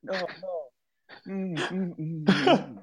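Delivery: high muffled moans, hammed up; mock muffled moaning. Tortured captive moaning back at the Night Lord, high and hammed up. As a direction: moan through a gag, clearly enjoying it. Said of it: "And they're like".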